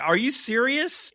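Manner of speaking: high, slow disbelieving squeak; can't believe what he's hearing; incredulous. Real, not acted. Host to co-hosts, disbelief at the accent defense, high and drawn out.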